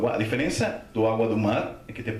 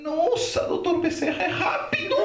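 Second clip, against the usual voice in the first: very high, comic astonishment; amazed relief. Retold or imitated. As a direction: exclaim in hammed-up amazement. Amazed that rescuer appears at once. (espanto cômico, voz bem aguda)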